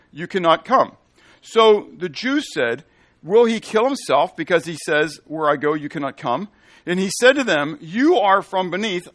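loud, ringing scripture reading; preaching scripture. Acted. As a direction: proclaim it to the congregation. Preacher reading Jesus' words.

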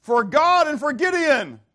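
high, loud rallying shout; battle cry. Acted. Preacher voicing the soldiers' battle cry, loud shout. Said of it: "we're gonna yell out".

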